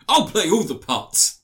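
slow, pompous mock director voice; lightly voiced grandiose filmmaker, drawn out; Ridley Scott. Friend mocking a director casting himself, hammed-up. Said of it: "I'm Ridley Scott".

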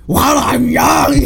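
high, drawn-out yell; mock impatient scream. Fan quotes the Grinch demanding his award, screaming. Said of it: "Just screaming".

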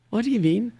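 high, questioning put-on voice; puzzled clerk. Clerk on the phone to caller, puzzled high mock voice.

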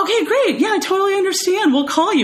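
mock the agent's hollow cheerful dismissal; fake-friendly casting agent. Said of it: "they were like".

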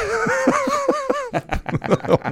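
shrieking high laugh; high squealing laugh. Real, not acted. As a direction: burst into squeaky giggles. Laughing at goodbye gag.

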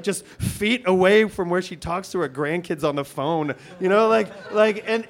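usual speaking voice

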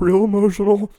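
mock emotional. Teasing cohost's cracking voice.

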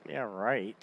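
low, soft mock scoff; eye-rolling dismissal. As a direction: imitate someone rolling their eyes. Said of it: "kinda rolled their eyes and were like".

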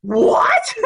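loud, incredulous cry; mimicked shocked reaction. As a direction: shout it back in comic disbelief. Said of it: "And he's like".

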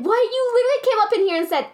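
high, fast outraged rant; yelling. Real, not acted. Still ranting to her cohost, high and fast. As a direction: keep yelling, rushing the words. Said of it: "Sorry, I'm yelling".

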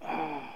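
long, soft exhale; heavy sigh. Real, not acted. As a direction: let out a quiet, breathy sigh. Pauses, moved, before testifying.

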